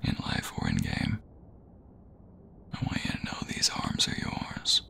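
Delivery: soft, close whisper to a lover; intimate whisper. Acted. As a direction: breathe the words right by their ear.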